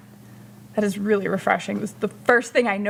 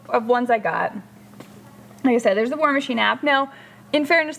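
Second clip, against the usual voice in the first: usual speaking voice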